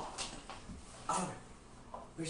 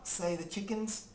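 usual speaking voice

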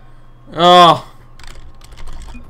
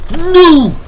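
frustrated shout; 12 semitones higher, 7 dB louder than usual. Real, not acted. Free kick about to be missed.

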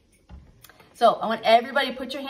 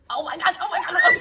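laughing alarm; 8 semitones higher, 6 dB louder than usual. Real, not acted. Puppet eating fingers.

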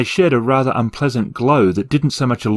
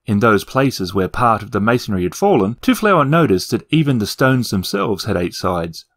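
ordinary delivery, nothing marked

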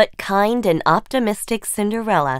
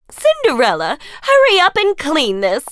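high, loud, impatient order; bossy stepsister; 6 semitones higher, 4 dB louder than usual. Acted. Stepsister rushing Cinderella to clean, high and impatient. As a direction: hurry the maid along.

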